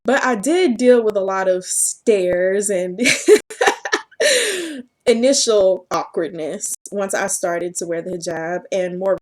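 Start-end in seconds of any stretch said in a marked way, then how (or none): 3.0-4.8 high laugh. Real, not acted. Laugh heard.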